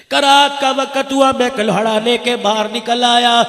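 loud, fervent sermon storytelling; full-force preaching. Acted. Preacher tells the crowd the story, fervently and loudly. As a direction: drive the story home to the crowd.

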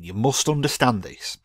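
ordinary delivery

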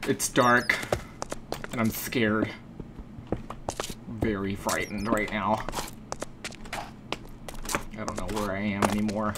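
a hushed, half-joking scared murmur; mock scared. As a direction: play at being scared, dropping the voice. Alone in the dark cave.

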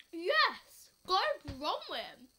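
triumphant shout of victory; excited cheer. Real, not acted. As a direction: cheer loudly, celebrating a win. Celebrating winning the challenge.

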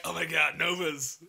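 imitating your own excited shout; retold excitement. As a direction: reenact a thrilled outburst. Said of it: "I was like".

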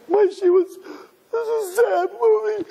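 exaggerated tearful whimper, high; mock crying woman. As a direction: wail it like a weepy moviegoer. Women crying at sad movie.

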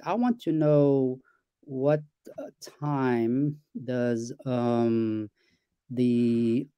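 slow, halting request with gaps; hesitant pausing. Shown as a demonstration. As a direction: trail off mid-question, searching for words. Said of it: "and then it interrupts".

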